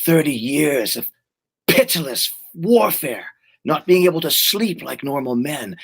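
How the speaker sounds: slow, weighty film monologue; lightly acted warrior speech, drawn out; Conan. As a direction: dwell on each phrase with pauses. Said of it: "and he goes".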